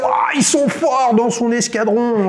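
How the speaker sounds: high-pitched game-show exclamation; hyped-up congratulation. Real, not acted. Host celebrates the squadron pilot's right answer, hyped up. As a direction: cheer the winning answer, drawing words out.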